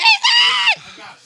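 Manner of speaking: high, loud, drawn-out yell; frantic shout. Real, not acted. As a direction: yell it out in a panic. Scrambling for milk.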